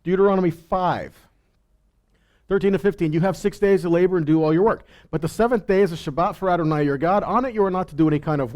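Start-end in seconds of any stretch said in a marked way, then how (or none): none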